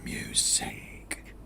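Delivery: low, drawn-out hushed echo; eerie, very soft slow whisper. Acted. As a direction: stretch each word out in a whisper. Echoes narrator in a creepy whisper.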